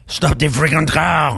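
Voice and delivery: put-on loud, high police command in a thick French accent; French-accented police shout. French friend yelling at a suspect, French-accented shout. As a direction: bark it like a French cop on patrol. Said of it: "very thick French accent".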